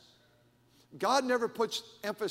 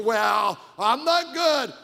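loud, high hammed-up objection; mock protest; 4 semitones higher, 11 dB louder, much slower than usual. Reluctant Sunday school teacher refusing a class, imitated as a loud mock protest. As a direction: holler the excuse, mocking the objector.

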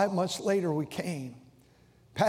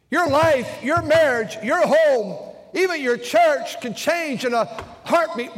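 louder, higher, slow at first, building sermon climax; full-force preaching; 5 semitones higher, 7 dB louder than usual. Acted. Pastor warning his congregation that life changes, preaching at full force. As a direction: preach it hard, pushing every phrase.

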